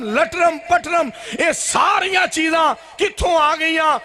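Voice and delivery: high, ringing preacher's oratory; impassioned preaching. Real, not acted. Preacher challenging the crowd over borrowed customs, in high, impassioned oratory. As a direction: fire off each item like an accusation. Preacher declaims at full force.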